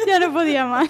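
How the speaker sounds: high laughing voice. Real, not acted. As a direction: laugh through the words. Recounts laughing fit, laughing.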